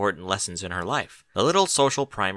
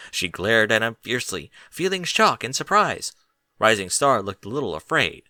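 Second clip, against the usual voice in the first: usual speaking voice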